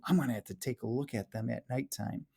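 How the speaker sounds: ordinary delivery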